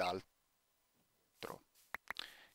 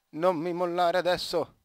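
loud, higher plea to the mouse; urgent appeal; 6 semitones higher, 6 dB louder than usual. Real, not acted. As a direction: implore it loudly not to quit. Pleads with dying mouse. (supplica accorata a voce alta)

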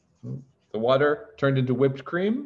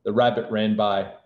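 usual speaking voice

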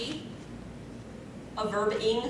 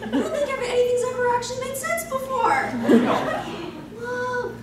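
much higher, amazed exclamation; mock amazement; 9 semitones higher, faster than usual. Real, not acted. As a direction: gasp in fake wonder, then laugh. Reacting to absurd text.

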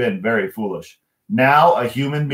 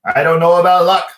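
louder and higher, rising anger; 7 semitones higher, 7 dB louder than usual. Acted. O'Leary answering Michael, voice rising. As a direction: let the temper start to climb. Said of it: "his voice rising".

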